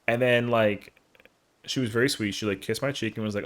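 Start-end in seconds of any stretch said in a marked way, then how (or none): none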